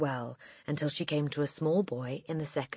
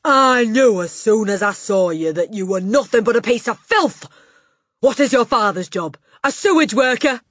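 loud, high outburst of disgust; furious tirade; 8 semitones higher, 12 dB louder than usual. A narrator's reading. Headmistress insulting the dirty boy, furious and loud. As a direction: rage at him at full volume.

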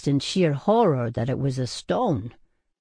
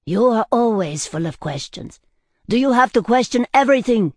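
raised-pitch complaint, building louder and quicker; exasperated; 7 semitones higher, 4 dB louder than usual. A narrator's reading. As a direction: throw it at your friend, fed up, ending high. Exasperated friend complains about questions.

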